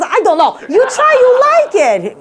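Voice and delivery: very high, loud mock accent; hammed-up vendor, drawing out the sales pitch; Chinese street vendor. Vendor dodges the customer's question, high drawn-out mock accent. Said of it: "He goes".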